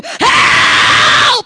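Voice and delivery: the right way to cry out; shouted help. Shown as a demonstration. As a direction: scream HELP like you're being robbed. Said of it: "What are you gonna do?".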